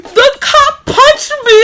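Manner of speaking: high, loud, drawn-out mock wail; tearful blurting. Bawling child telling dad about the cop, tearful. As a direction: imitate bawling it out, slow and high. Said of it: "only thing I could get out".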